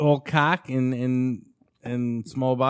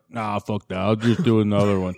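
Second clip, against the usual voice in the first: lower, lazy Stallone mumble; 6 semitones lower than usual. Retold or imitated. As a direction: drop low and do Stallone. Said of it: "Stallone was like".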